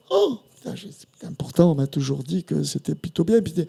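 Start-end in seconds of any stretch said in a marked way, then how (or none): 0.1-0.9 breathless whispered curse, high; shocked whisper. Retold or imitated. Singer retelling his shock at the [name] double's words, whispered curse. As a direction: hiss it out under your breath in dismay.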